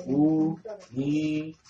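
"Unigénito" drawn out slowly, each syllable separate. Shown as a demonstration. Preacher teaching the congregation a key word, syllable by syllable. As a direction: break "Unigénito" into long, spaced syllables.

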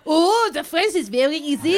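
put-on French accent; mock-French accent; French guy. Shown as a demonstration. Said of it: "give us your French guy".

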